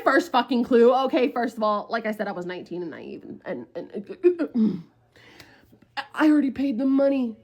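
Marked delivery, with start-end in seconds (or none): none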